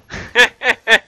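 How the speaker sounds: high, short burst of laughter; gloating laugh. Real, not acted. Player bragging to the boys about MVP, gloating laugh. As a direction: laugh after the boast.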